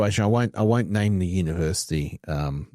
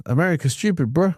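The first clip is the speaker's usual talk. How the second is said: high, mock Kiwi voice of a friend; a friend's Kiwi accent, imitated; 10 semitones higher, 3 dB louder than usual. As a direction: quote your mate in a Kiwi twang. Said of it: "it sounds like a Kiwi accent".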